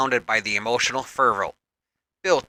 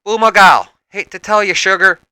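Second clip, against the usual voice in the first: loud, higher Southern belle drawl; sassy Southern drawl, higher and louder; 7 semitones higher, 9 dB louder than usual. A narrator's reading. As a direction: tease her with a Southern drawl. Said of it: "Rogue in her southern drawl".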